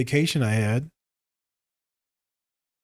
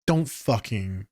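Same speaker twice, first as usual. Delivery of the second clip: ordinary talk, nothing marked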